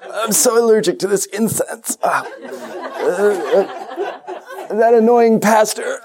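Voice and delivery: high, nasal-free mock complaint, laughing through it; whiny churchgoer. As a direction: whine like a fussy Lutheran in the pews. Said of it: "all the pious Lutherans are on the side going".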